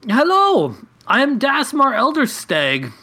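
shouting back. Acted. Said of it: "I shout back".

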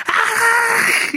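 piercing high shriek of a burning vampire; vampire scream. A narrator's reading. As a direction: let out a sudden agonized monster scream. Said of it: "screams out again".

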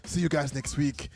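playful Nigerian dad sign-off; dad's Nigerian accent. Acted. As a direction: imitate a Nigerian father signing off to fans. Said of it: "imagine it's your dad doing it".

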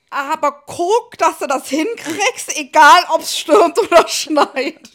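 hammed-up slow warning, laughing; drawn-out mock warning to the son; mock stern mother. Mother voices instruction to son, laughing. (gespielt strenge Mutter, langsam und lachend)

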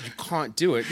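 imitating classmates aping a British accent; mocking British accent. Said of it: "by everyone that says".

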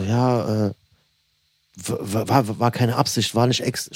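imitated hesitant, stammering excuse; leichtes Stottern. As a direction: stumble over the first words, mimicking him. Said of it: "fängt so ein bisschen so leicht an zu stottern". (leicht stotternd nachgemacht)

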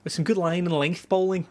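high-pitched attempt at Scouse; badly attempted Liverpool accent. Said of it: "should have attempted a Liverpool accent".

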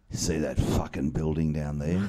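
low, soft voice; mock patient mumble. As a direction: imitate a patient talking from the bed. Retells Fiona speaking from hospital bed.

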